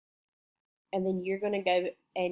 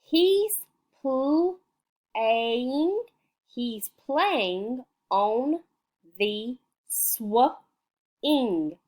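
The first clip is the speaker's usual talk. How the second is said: sing-song read-aloud; 3 semitones higher, 3 dB louder, much slower than usual. Shown as a demonstration. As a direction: read it to little ones with swing. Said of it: "let's read this story".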